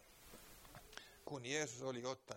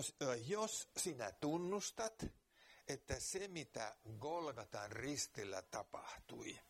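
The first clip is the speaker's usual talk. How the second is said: earnest preaching; 3 semitones higher than usual. Real, not acted. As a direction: press the point home to the listener. Preaching the gospel call. (kiihkeä saarnaaminen)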